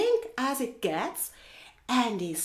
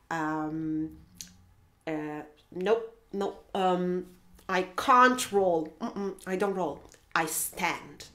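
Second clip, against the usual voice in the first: low, hesitant, matter-of-fact refusal; reluctant flamingo; 5 semitones lower, slower than usual. Acted. Flamingo turning down hippo's rolling idea, low and unhurried. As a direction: decline haltingly in a low voice. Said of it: "And Flamingo says".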